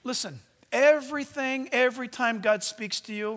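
normal talking voice

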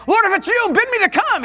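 urgent, high-pitched plea; Peter. Acted. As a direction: call out loudly and eagerly, acting the disciple. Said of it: "And Peter, he's saying".

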